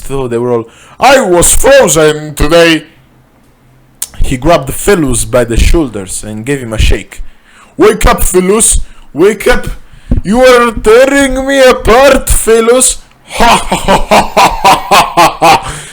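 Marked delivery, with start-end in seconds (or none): 1.0-2.9 high, loud quoted outburst; mock outburst. Blurts out a quoted movie line. 7.8-13.0 very high, loud melodramatic plea; overacted anguished yell; hammed-up desperate shouting. Benzai shakes his frozen friend, pleading. 13.2-15.9 high, loud maniacal cackle; staccato mad-scientist laugh; villain laugh. Acted. Villain's laugh announces his arrival.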